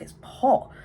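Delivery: surprised exclaim. Retold or imitated. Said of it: "I was like".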